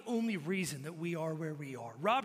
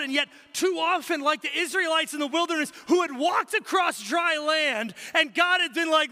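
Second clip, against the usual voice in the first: louder, higher, building sermon delivery; rally the congregation with rising fervor; preacher pressing the warning home; full-force preaching; 8 semitones higher, 8 dB louder than usual. Acted. Preaching about the Israelites' golden calf.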